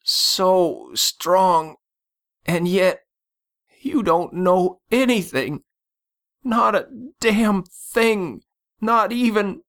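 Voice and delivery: high, overwhelmed gasp in broken phrases; gasping, giving way. A narrator's reading. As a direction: strain upward as if your strength is failing. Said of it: "he gasped, giving way".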